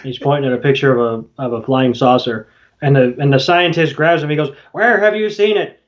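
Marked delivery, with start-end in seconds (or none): 4.7-5.8 a touch higher, eager question; eager demand. Retold or imitated. Scientist grabs the chef, eager and pressing. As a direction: press him for where he saw it.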